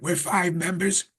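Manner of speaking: ordinary delivery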